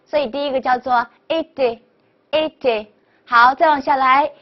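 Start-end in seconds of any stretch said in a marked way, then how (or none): 1.3-2.9 "A D" slowly as été, closed ay; closed é pronunciation. Shown as a demonstration. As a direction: model "A D" with small-mouth é twice. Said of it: "应该发的是A这个音".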